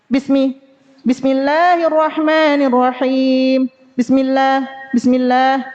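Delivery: BISMI with a short s that does not flow; rushed sin. Shown as a demonstration. Teacher showing the fault, sin read too quickly. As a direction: hurry through the s of BISMILLAH, cutting its hiss. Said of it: "jadi bacanya cepat".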